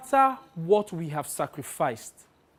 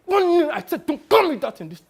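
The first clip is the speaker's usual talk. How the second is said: high, strained, louder outburst; Sonny, exasperated; 10 semitones higher, 7 dB louder than usual. Acted. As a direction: repeat the warning with panicked annoyance. Repeats warning, losing patience.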